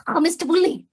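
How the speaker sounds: mock yell. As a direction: yell out the food name like an audition. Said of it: "she literally was like".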